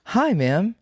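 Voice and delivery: high, bright polite greeting; sweet phone-rep hello; customer service voice. Shown as a demonstration. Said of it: "go to my customer service voice".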